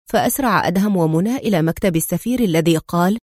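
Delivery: ordinary delivery, nothing marked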